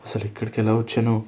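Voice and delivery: ordinary talk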